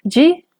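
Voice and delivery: "Ji" pitched high. Shown as a demonstration. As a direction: say "Ji" lifting to a high tone. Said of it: "Todas estas palabras tienen tono alto".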